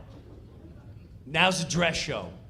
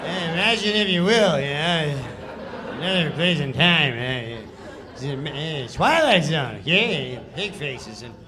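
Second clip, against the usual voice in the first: loose, laughing, careless narrator read; Norm's sloppy read; 4 dB louder than usual. Retold or imitated. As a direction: toss it off like you don't care. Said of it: "this is how he reads the sketch".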